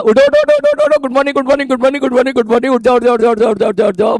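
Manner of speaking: high squealing singsong wake-up call; mock girls' mimicry. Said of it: "मेरी सबसे ज्यादा नकल तो मैं समझ गया छोरियां ही करती होगी".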